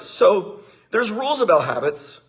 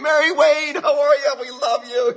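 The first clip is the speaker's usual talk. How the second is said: calling out; 6 semitones higher than usual. Real, not acted. Calling out to a visitor.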